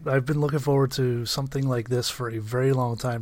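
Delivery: normal talking voice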